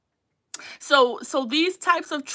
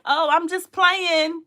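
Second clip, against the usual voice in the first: slow, higher, louder mock "just playing"; mocking excuse; 6 semitones higher, 7 dB louder, slower than usual. Host mocking a troll's slow, louder fake excuse. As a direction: drag out the fake innocent excuse. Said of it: "They're like".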